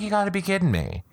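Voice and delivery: high, fast you gotta be kidding me; retelling her stunned reaction to the tweets; disbelief. Retold or imitated. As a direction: blurt it out in shock. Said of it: "And I'm like".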